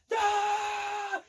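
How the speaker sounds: high, held mock scream; long high "ah" yell; imitated scream. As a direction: cry out like a falling man. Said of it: "screaming like this".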